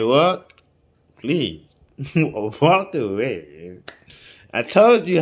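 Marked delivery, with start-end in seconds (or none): 0.0-3.8 drawn-out "Walk away" urging them to leave; slow pleading. Acted. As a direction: stretch "Walk away" out slowly with a laugh. Pleading with shooters, laughing.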